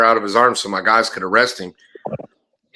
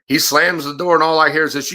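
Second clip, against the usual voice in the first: ordinary talk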